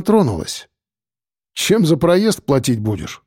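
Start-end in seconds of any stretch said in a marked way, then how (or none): none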